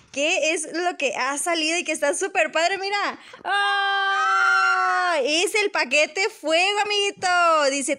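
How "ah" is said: high delighted shriek; excited shriek. Real, not acted. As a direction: squeal with joy at the surprise. Grito al ver el paquete. (grito de emoción)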